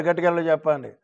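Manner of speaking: ordinary talk, nothing marked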